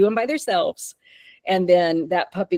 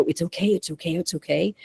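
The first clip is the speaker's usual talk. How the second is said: softer, lightly soothing repeated reassurance; mock soothing owner; 5 dB quieter than usual. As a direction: imitate an owner cooing at the dog. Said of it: "look at your dog and go".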